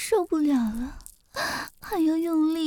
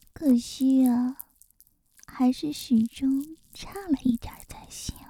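teasing whisper; 4 semitones lower than usual. Acted.

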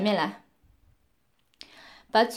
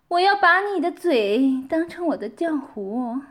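ordinary delivery

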